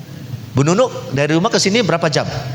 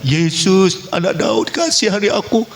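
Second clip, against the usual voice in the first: imitated cry for mercy; mild mock shout of a blind beggar; blind man crying out; 6 semitones higher than usual. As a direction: call out high, pleading, not full volume. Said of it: "dia teriak".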